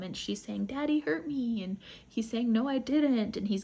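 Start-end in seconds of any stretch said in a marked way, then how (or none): 0.7-1.6 put-on higher, small child's voice; little girl voice. Injured little girl speaking. 2.5-3.4 louder, higher, snapping denial; angry denial. Retold or imitated. Father denying angrily.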